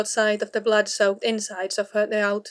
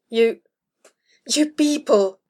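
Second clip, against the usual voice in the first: exasperated shout; 4 dB louder, slower than usual. A narrator's reading. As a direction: burst out at them, exasperated. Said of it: "she shouted, exasperated".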